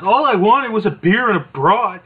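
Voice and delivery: hammed-up grumble; Mean Gene. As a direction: moan about missing your beer. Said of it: "Okerlund's like".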